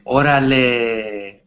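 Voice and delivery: Órale stretched out slowly; drawn-out slang. Shown as a demonstration. Teacher showing a Mexican slang word, drawn out slowly. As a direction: savor the word, slow and long. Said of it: "such a fun phrase".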